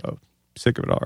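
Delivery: hushed, breathy aside; whispered recognition. Retold or imitated. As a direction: whisper it like spotting a celebrity. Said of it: "someone goes".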